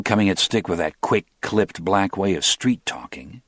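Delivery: usual speaking voice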